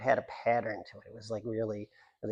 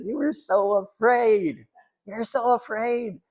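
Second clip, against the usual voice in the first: slow, higher mock voice of the patients; higher, drawn-out imitation of a psych patient; patients teasing; 10 semitones higher, 5 dB louder, slower than usual. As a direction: stretch it out like a gentle teasing patient. Patients voiced in retold story.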